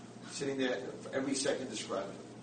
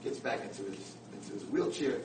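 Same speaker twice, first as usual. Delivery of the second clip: ordinary delivery, nothing marked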